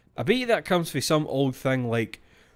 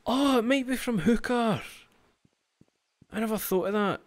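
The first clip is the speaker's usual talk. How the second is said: realisation; 10 semitones higher than usual. Real, not acted. Sudden realisation about the word.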